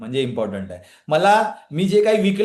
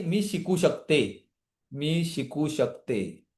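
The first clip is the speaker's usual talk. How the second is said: मी शिकू शकते said slowly and softly, मी leaned on; calm self-belief, spoken gently and slowly; quiet slow reassuring affirmation, मी शिकू शकते; 3 semitones lower, 5 dB quieter, much slower than usual. Shown as a demonstration. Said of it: "मग त्याच्याऐवजी काय बोलायचं आहे?".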